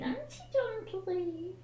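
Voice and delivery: high, soft cooing to the dog. Real, not acted. Owner coos softly at her sleeping dog in baby talk.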